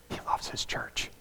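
whispered, very soft; hushed whisper. Real, not acted. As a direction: breathe it to the congregation. Preacher drops to a whisper.

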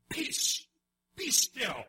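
loud, forceful command to the storm; commanding rebuke. Acted. Jesus rebuking the wind and sea, loud command. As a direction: order the sea to be still with full authority. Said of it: "he rebuked the wind and said to the sea".

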